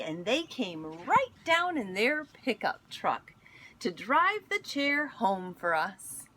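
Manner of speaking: gentle storybook read-aloud; picture book reading voice; reading to children. Acted. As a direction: read it like story time for kids.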